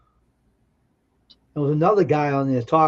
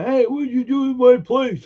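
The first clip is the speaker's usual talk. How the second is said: angry owner; 10 semitones higher, 4 dB louder than usual. Retold or imitated. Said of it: "Just say".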